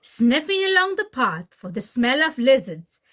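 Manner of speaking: ordinary delivery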